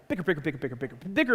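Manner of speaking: quick low rattle of BICKER; rapid bickering patter. Retold or imitated. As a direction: rattle it off like nonstop squabbling. Mimics the endless bickering.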